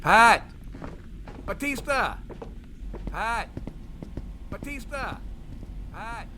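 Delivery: high calls that fade off into the distance; calling, fading away. Acted. Bruno calling for Pat and Batista as he goes, fading. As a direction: call out while moving farther away.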